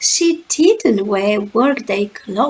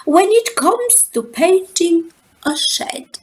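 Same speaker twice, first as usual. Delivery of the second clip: higher grandmotherly lilt; higher Grandmama voice; 7 semitones higher than usual. A narrator's reading. Grandmama on modesty, higher voice. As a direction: sing it up like a dotty grandmother. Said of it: "she said".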